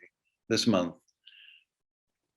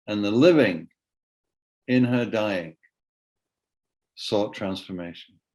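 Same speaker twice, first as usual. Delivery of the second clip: spaced phrases, falling at each pause; poem reading. Acted. Poet ends the poem about Rosanna, spaced measured reading. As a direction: deliver it as a poem's weighty close. First reading of the closing lines.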